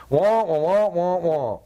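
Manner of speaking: muted trumpet wah-wah of the cartoon teacher; Charlie Brown teacher noise. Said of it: "the teacher, remember?".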